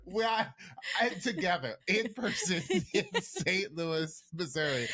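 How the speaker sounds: talking through a fit of laughter; helpless giggles breaking up the words; laughing. Real, not acted. Host giddy at being together in person, laughing. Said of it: "I can't stop laughing".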